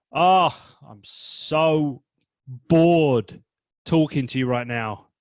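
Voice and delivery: loud groaning boredom with an ugh; fed-up groan. Shown as a demonstration. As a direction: moan it out bored. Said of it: "That's real boredom".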